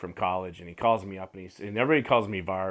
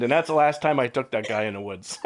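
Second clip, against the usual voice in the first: normal talking voice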